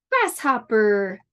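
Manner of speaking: slow Grasshopper, three clear beats; clapped syllables. Shown as a demonstration. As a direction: clap out Grasshopper slowly. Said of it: "How many claps?".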